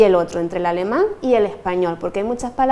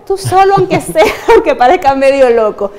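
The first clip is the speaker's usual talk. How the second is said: laughing; 5 semitones higher, 6 dB louder than usual. Real, not acted. Jokes about talking to yourself.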